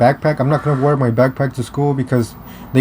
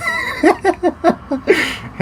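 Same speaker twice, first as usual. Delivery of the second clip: squealing high laugh; high burst of laughter. Real, not acted. Laughing at bully being bullied.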